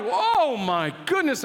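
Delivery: ordinary talk, nothing marked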